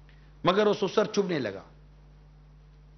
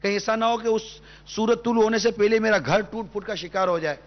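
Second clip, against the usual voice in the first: higher, impassioned sermon voice; fervent preaching; 6 semitones higher than usual. Acted. Preacher urging the sisters on TV, impassioned and higher. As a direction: plead with the listening sisters, voice lifted high.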